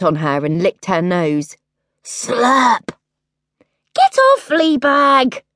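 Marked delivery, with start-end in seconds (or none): 2.0-2.9 wet licking sound; slurp noise. A narrator's reading. Dog licking princess's nose, wet slurp. 3.9-5.4 high, loud yell at the dog. A narrator's reading. Princess yelling at the dog on her bed. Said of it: "Rudica yelled".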